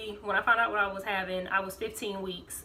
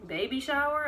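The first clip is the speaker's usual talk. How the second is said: shocked question; 7 semitones higher than usual. Retold or imitated. His mom shocked at news.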